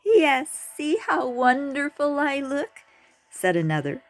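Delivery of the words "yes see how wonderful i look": high, preening show-off voice; vain sow. A narrator's reading. A sow boasts to the turtle about her looks, high and preening. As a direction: admire yourself out loud.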